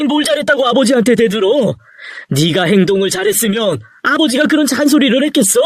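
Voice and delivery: worked-up parental rebuke; angry scolding. Acted. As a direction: put a defiant son in his place. Father angry at backtalk.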